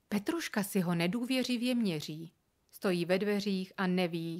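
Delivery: ordinary delivery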